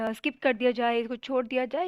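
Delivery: ordinary delivery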